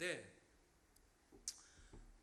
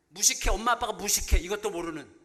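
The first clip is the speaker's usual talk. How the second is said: mock child scolding; 10 dB louder than usual. Child's view: parents are ignorant.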